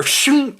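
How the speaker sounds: high imitated blade swish; quick sibilant sound effect; sword unsheathing noise. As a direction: voice a sabre sliding free. Imitates sabre being unsheathed.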